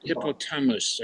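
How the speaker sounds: ordinary talk, nothing marked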